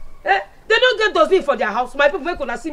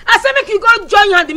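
louder, higher, slow command; stern order; 6 semitones higher, 7 dB louder than usual. Acted. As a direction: bark the order at her. Ordering Adaku to help.

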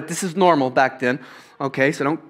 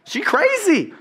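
incredulous squeal; 7 semitones higher than usual. Retold or imitated. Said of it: "but, like".

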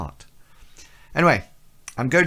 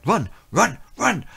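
high, urgent repeated command; mock inner alarm going off; retold panicked brain; 9 semitones higher, 3 dB louder than usual. Part of the brain urging escape from a stranger, retold panic. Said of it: "another part of my brain is saying".

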